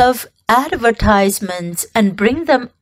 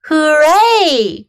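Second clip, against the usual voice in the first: cheer; 5 semitones higher, 4 dB louder than usual. Acted. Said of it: "cried everybody".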